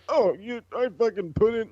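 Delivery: high, squeaky mock whine of a complainer; mocking whiny Dolph. Mocking Dolph Ziggler to friends in a high whiny voice. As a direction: whine it up high like a petty heel.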